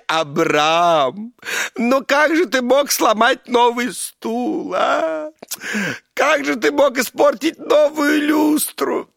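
high, loud, slow wailing housewife; wailing lament. Acted. Sarah wailing at Abram over the broken chair. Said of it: "стоит посреди комнаты и причитает".

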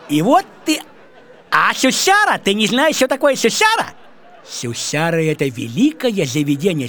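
normal talking voice

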